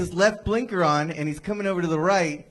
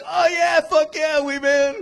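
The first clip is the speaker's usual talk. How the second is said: much higher, louder starstruck cop; excited cops; 11 semitones higher, 4 dB louder, slower than usual. Retold or imitated. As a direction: cops thrilled to see Wee Man. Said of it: "cops are just like".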